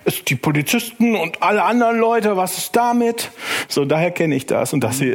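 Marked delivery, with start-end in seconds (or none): 0.0-3.3 high, mock indignant objection; mock whiny objection. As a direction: whine it like a troll. Acting out a troll's reply.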